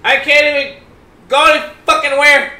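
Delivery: loud, high gamer rant; frustrated shouting. Real, not acted. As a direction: shout it, fed up with the game. Trapped, yelling at zombie game.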